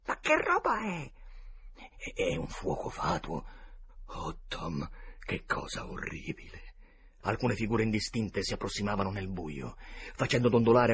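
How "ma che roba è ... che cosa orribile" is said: put-on scared boys. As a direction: play a terrified boy hiding among graves. Boys see the will-o'-the-wisp.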